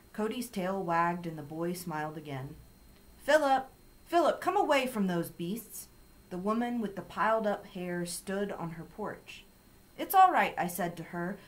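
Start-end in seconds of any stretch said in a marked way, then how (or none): 3.2-5.9 loud, high-pitched summons; alarmed calling. A narrator's reading. Woman calls boy from porch. 10.0-10.5 loud, high call of reassurance; calling back. A narrator's reading. Girl calls back to alarmed neighbour.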